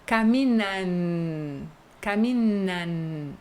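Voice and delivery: "Caminan" very slow and soft, final N held with tongue up; teacher exaggerating the Spanish N; exaggerated final N. Shown as a demonstration. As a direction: stretch "Caminan" slowly, ending on a tongue-tip N. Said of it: "você tem que colocar a língua aqui".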